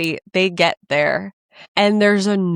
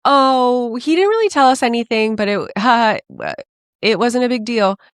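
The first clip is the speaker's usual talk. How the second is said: breezy downplaying; 6 semitones higher, 4 dB louder than usual. Staff downplaying in oral history.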